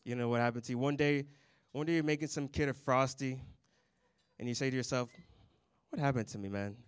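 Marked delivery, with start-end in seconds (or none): none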